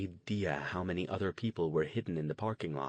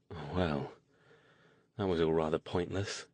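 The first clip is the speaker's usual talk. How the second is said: sighing, lower and let down; weary sigh; 4 semitones lower than usual. A narrator's reading. Stone giving up after a pointless errand, sighing. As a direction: let the words sag out on a tired breath. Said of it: "Stone sighed".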